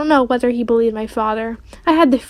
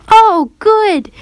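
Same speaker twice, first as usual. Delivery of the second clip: put-on high, loud little-kid cheer; gleeful toddler yell; 10 semitones higher, 5 dB louder than usual. A narrator's reading. Fudge to his father on tasting omelet, gleeful yell. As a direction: yell it high like a delighted small child. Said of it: "he yelled".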